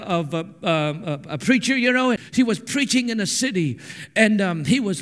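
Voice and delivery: ordinary talk, nothing marked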